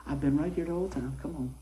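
hushed retold voice of God; softly, as if into an ear; whispered. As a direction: let the voice sink to a soft murmur. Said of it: "when he whispered in my ear".

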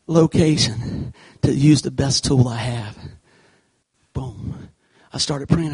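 ordinary talk, nothing marked

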